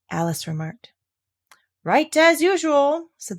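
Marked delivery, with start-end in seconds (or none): 1.8-3.1 high, loud, slow approval; drawn-out grand praise; hearty Duchess. Acted. Duchess to Alice, slow high approval.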